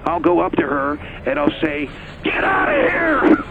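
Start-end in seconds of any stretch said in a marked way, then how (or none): 2.2-3.4 furious high shout. Pretend. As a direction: yell it at the top of your lungs. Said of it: "is that what you wanted me to do".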